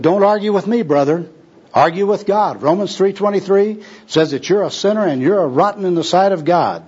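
high-pitched scriptural rebuke; argumentative preaching. Acted. Gospel worker quotes Romans at tramp, combatively.

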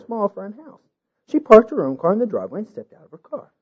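plain reading voice

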